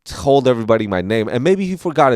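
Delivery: ordinary talk, nothing marked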